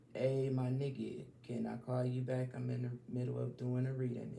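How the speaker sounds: hushed phone aside, quick and soft; dodging a caller. Real, not acted. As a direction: wave off the caller quietly.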